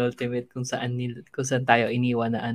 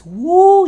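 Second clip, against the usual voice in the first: a big whooping cheer, way up high; loud cheer; 17 dB louder than usual. Real, not acted. Host rallying the listeners at the opening, whooping cheer. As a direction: throw your whole voice into a woo.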